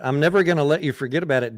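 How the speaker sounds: quick, playful rattle-off; fast teasing echo. Real, not acted. Streamer throwing the viewer's line back, fast and teasing.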